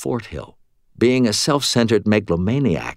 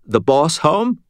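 high, forced-casual question; trying to sound casual; 8 semitones higher, 4 dB louder than usual. A narrator's reading. Dangling Fitz asking after the boss, forced casual. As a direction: ask it as if nothing's wrong. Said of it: "trying to sound casual".

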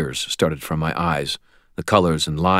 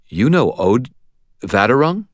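higher, louder, rising disbelief; surprised question; 7 semitones higher, 6 dB louder than usual. A narrator's reading. Startled question on learning of acquaintance.

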